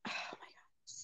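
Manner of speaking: hushed under-the-breath oh my God; a real whispered aside before bad news. Real, not acted. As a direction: whisper it as if bracing yourself. Dreading the next part of story.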